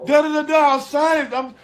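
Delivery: high, wailing mock sob; imitated crying plea. Bride begs Steve after the wedding is called off, high wailing mock cry. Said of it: "she hard down crying".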